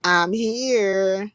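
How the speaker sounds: slow, hammed-up come-on; mock flirt. Teasing Big O for hitting on the ladies, slow mock flirt. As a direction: drag it out like a try-hard flirt. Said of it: "Big O come in like".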